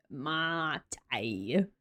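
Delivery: "Mate" whispered low as mah-tee; a soft whispered "Mate", two syllables. Shown as a demonstration. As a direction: breathe "Mate" under your breath, mah-tee. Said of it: "mate sounds so Aussie".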